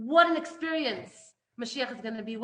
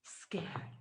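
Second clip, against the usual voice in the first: a frightened whisper, much softer; scared whisper; 10 semitones lower, 16 dB quieter than usual. Shown as a demonstration. Teacher showing how fear sounds, a frightened whisper. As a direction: breathe the word scared in fear. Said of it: "You act".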